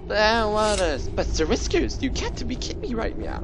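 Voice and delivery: high whiny disbelief; whiny protest. Retold or imitated. Player whining at the Greybeards in mock disbelief. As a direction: whine it up high like a sulking kid. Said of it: "been looking for an excuse to say that".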